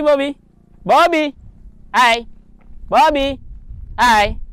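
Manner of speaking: high, loud call to the bird. Real, not acted. Owner calling his trained bird back, high and loud. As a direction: call him back, sing-song and loud.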